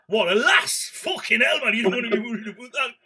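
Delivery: hammed-up Geordie mate scoffing at Clint; incredulous Geordie bloke. Geordie bloke imagined telling Clint not to worry, mock incredulous. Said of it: "if it was a Geordie bloke".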